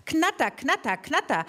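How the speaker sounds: chopped "Knatter" like a rattling engine; imitating a clattering lawnmower; mower rattle imitation. Shown as a demonstration. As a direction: rattle the words out like a motor.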